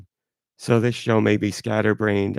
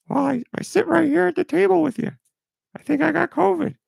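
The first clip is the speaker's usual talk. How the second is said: very high squeaky put-on voice; a tiny high-voiced pal admitting he's sick; George voice. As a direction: falsetto character voice, mildly glum.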